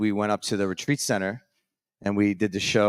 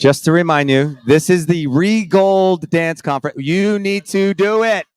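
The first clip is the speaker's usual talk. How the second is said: playful insistence; 7 semitones higher, 9 dB louder than usual. Real, not acted. Pressing Ree to play.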